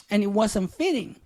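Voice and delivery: ordinary talk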